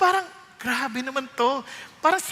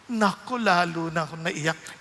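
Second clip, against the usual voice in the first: usual speaking voice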